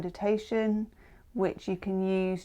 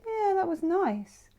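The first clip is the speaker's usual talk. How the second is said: much higher-pitched, pleased little aside; high, mock-contented voice; acted contentment; 11 semitones higher than usual. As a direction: lift it high like a satisfied thought. Acting contented thought after a snack.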